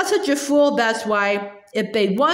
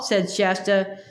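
ordinary delivery

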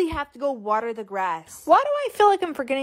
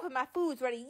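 ordinary delivery

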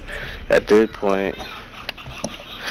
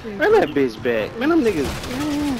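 high, heated complaint; exasperated outcry; 14 semitones higher than usual. Real, not acted. Gamer to enemies who got his teammate, exasperated high outcry. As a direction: pitch jumps up in protest.

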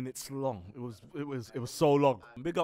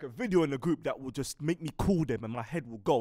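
usual speaking voice

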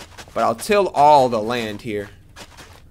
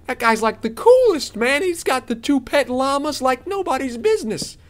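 high-pitched, thrilled rave; excited gushing; 10 semitones higher than usual. Real, not acted. Gamer glad he met the merchant, excited gushing.

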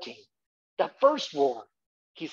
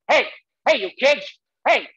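put-on loud, high yell at kids; hey you kids, hollered off the porch; shouted hey you kids; 6 semitones higher, 6 dB louder than usual. Shown as a demonstration. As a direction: yell like an angry homeowner. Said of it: "I want you to say".

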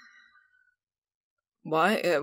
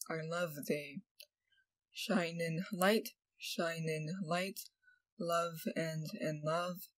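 lyric reading; 5 dB quieter than usual. Acted. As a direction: read your own love song aloud, gently and unhurried. Reads own love lyrics aloud.

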